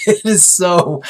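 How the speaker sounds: breaking into real laughter mid-sentence, drawn out; can't get the words out for laughing; laughing out loud. Real, not acted. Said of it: "I'm laughing out loud thinking about this".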